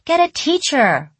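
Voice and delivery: high, loud cry for help; wailing child. A narrator's reading. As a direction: call out like a panicked schoolkid. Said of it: "The other kids started wailing".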